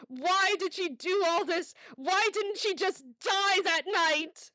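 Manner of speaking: high, loud tearful outcry; anguished self-blame. Acted. Mother blaming herself, crying out loud. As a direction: rail at yourself through sobs.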